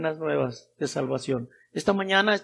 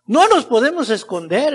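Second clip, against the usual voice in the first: raised exhortation, falling away after a strong start; emphatic preaching; 6 semitones higher, 9 dB louder than usual. Real, not acted. Preacher telling the brethren they cannot hide, emphatic declaration. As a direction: declare it firmly to the congregation.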